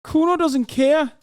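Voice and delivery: very high, drawn-out character voice; Kuno. Acted. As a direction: draw it out in a high put-on voice. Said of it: "a character from Disco Elysium".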